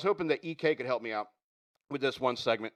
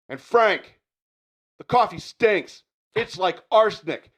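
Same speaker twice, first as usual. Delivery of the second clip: raised, loud parting complaint; angry rant; 6 semitones higher, 6 dB louder, slower than usual. Acted. Worker telling boss Frank his coffee stinks, heated rant. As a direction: fire off a last grievance, pitched up. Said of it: "And the rant begins".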